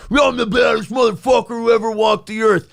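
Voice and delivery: mock bragging shout. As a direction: bellow it like a cocky tough guy. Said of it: "I'm certainly not in here every day going".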